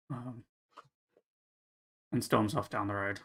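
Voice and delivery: ordinary delivery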